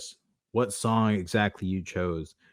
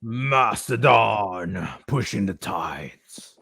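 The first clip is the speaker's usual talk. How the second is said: announcer voice; 2 semitones higher, 6 dB louder, much slower than usual. Acted. Announcing the song title.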